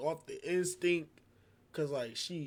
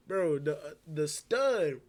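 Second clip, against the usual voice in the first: ordinary delivery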